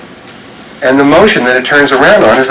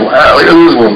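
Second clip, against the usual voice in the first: ordinary delivery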